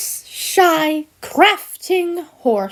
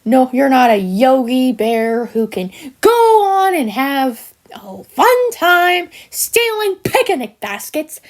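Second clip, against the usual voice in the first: ordinary talk, nothing marked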